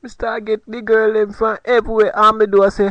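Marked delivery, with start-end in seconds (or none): none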